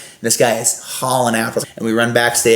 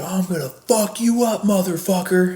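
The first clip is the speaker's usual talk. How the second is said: imitating a slow, higher-pitched threat; mock tough guy drawing out his threat; threatening man; 7 semitones higher, 4 dB quieter than usual. Angry guest squares up to the performer, mock menacing.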